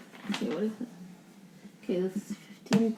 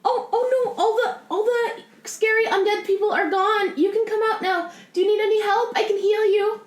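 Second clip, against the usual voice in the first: higher, gentle healer comforting; kind cleric; 9 semitones higher than usual. Acted. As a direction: soothe a frightened stranger. Player voices her cleric.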